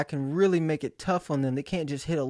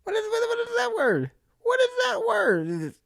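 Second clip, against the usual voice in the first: much higher, halting confused voice; stumped student. Retold or imitated. Kid stuck reading aloud in class, high confused stammer. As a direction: stumble up high on the word.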